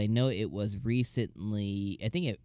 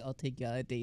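hushed aside; 9 dB quieter, much faster than usual. Retold or imitated. Cain muttering with the king behind.

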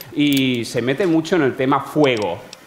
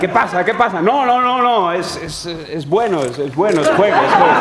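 high reassuring voices; 6 semitones higher, 4 dB louder than usual. Retold or imitated. Old folks and staff reassuring.

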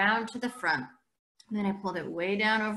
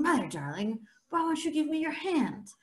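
mock blind plea; 7 semitones higher than usual. Mock blind boy pleading.

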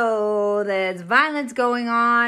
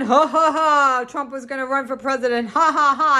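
mocking laughter; 3 semitones higher than usual. Imitating critics who laughed at her, in snide mock laughter. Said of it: "when they were laughing".

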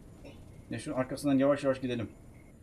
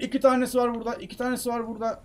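high-pitched alarmed repeat; startled, pitch jumped up; 8 semitones higher, faster than usual. Real, not acted. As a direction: warn of sudden danger, voice up high. Alarmed at two enemies appearing.

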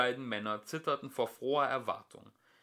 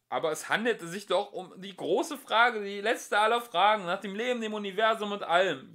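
high, louder whining plea; 11 semitones higher, 8 dB louder than usual. Acted. Lunkual whines at the computer in dismay. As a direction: whine like a let-down child. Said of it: "jammerte Lunkual".

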